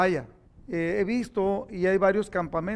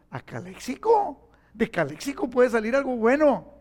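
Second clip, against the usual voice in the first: mock surprise; 4 semitones higher than usual. Preacher joking about being sent to Calexico, mock disbelief. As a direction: play up the dismay for a laugh. Retold joke reply to God.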